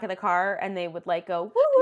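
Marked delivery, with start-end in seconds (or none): 1.4-1.8 high, loud woo-woo cheer; excited whooping. Retold or imitated. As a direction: whoop it out like a car full of girls. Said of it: "The Divine Secrets of the Ya-Ya Sisterhood".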